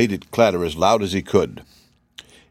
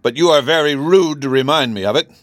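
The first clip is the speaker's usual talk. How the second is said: loud, higher scolding; indignant; 6 semitones higher, 5 dB louder than usual. A narrator's reading. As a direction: rebuke the servant. King scolds steward.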